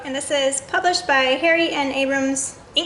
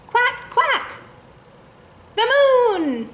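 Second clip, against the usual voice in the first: high, loud thrilled cry; excited exclamation; 11 semitones higher, 4 dB louder, much slower than usual. Acted. Teacher reading to children, thrilled about the moon. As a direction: announce it like a wonderful surprise. Repeated climax read to kids.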